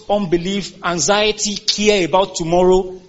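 normal talking voice

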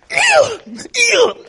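very high, loud healing commands falling hard; commanding shout. Retold or imitated. Preacher reenacting healing the sick bay, shouting. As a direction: hurl each heal like a command.

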